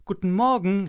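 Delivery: ordinary delivery, nothing marked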